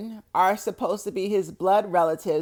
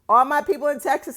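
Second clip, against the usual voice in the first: excited shout-out; 7 semitones higher, 6 dB louder, faster than usual. Real, not acted. Shout-out to Texas fans.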